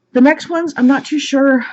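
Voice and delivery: breathless from running around; out of breath. Real, not acted. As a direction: shallow breathing, winded. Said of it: "sorry I'm out of breath".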